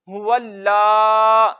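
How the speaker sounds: हुवल्ला slowly, ल held, ला stretched; doubled and long lam. Shown as a demonstration. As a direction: stop on ल then lengthen ला. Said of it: "लाम पे थोड़ा सा रुकेंगे भी". (हुवल्ला, लाम पर रुककर लंबा)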